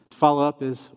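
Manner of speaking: usual speaking voice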